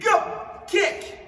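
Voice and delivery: high, loud karate yell; kiai shout. Shown as a demonstration. Karate teacher shouting the kick call for students, loud. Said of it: "Plus vite".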